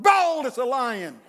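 slow, loud proclaim; preaching at full force. Real, not acted. Preacher answering his own question, slow loud proclamation. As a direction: bold as a lion, slow and loud. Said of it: "the righteous will be what?".

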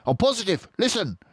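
high urgent voice; insist, tense; urgent fox; tense Mister Fox. Acted. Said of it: "she whispered".

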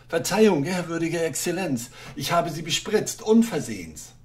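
ordinary delivery

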